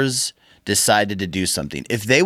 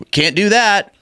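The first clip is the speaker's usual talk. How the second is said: high, louder mock-official no with a knowing tone; wink-wink refusal; 9 semitones higher, 4 dB louder, slower than usual. Union rep hinting to members while officially refusing. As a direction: say no while clearly hinting yes. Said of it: "wink, wink, nod, nod".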